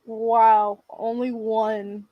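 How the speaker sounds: usual speaking voice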